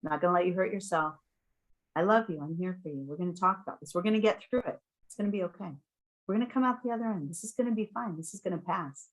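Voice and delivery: soft, soothing retold mantra; calming. Parent holding a fighting child until it passes, softly calming. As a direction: quietly reassure a struggling child. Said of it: "calming things".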